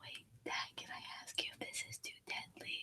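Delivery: breathy hushed question; ASMR whisper. Real, not acted. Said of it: "A S M R".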